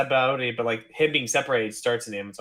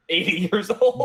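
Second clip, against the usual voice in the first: amazed; 9 semitones higher, 4 dB louder than usual. Real, not acted. Amazed at Rayleigh's age.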